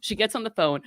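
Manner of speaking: ordinary talk, nothing marked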